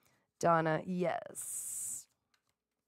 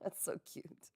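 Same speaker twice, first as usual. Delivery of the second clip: soft whisper, much quieter; whispered aside; 12 dB quieter than usual. Real, not acted. Fond aside to the crab commenter, whispered. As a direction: breathe it out fondly.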